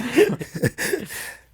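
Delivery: big high-pitched laugh. Real, not acted. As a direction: burst out laughing. Laughing at the memory of the show.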